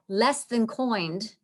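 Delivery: "coined" with an oy vowel, drawn out; old vowel making "coined" sound like coin; original pronunciation. Shown as a demonstration. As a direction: slowly say kind as "coined". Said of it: "sounds like a coin".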